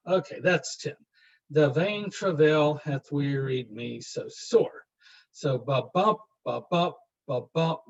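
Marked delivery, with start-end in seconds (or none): 1.5-4.9 slow, the beat on every second syllable; iambic stress, slow. Shown as a demonstration. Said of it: "The emphasis is on the second beat". 5.3-7.8 "ba BUMP" with the second beat rising; iambic beat. Shown as a demonstration. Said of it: "The emphasis is on the second beat".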